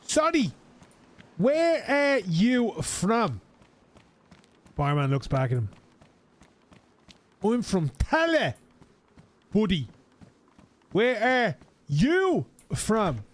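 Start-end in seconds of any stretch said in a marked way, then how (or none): 0.1-3.4 high, slow, over-careful English; pitched-up English, stretched out for the barman; slow tourist English. Retold or imitated. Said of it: "slowing down their English tune". 7.4-13.2 high, slow barman talking for a tourist; pitched-up careful English, stretched out. Retold or imitated. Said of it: "slowing down their English tune".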